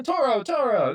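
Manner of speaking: high, loud mock bullfighter cry; matador call. Mimicking a matador waving the cape, mock cry. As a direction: call the bull like a hammed-up matador. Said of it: "you know what it sounds like?".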